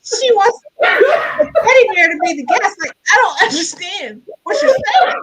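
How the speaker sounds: high, loud incredulous reenactment; exasperated, high-pitched mock outrage; laughing disbelief. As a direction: shout it in comic bafflement, laughing. Incredulous retelling amid laughter.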